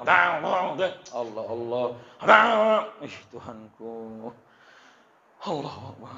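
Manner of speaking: mock groan of annoyance at the noise; half-hearted exasperated Allah. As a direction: sigh to God in mock irritation. Annoyed by guitar during prayer.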